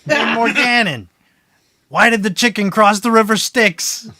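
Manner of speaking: high, loud jeering joker; taunting voice. Acted. Voice behind the door taunting the demon, high and loud. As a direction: call through the door to mock him.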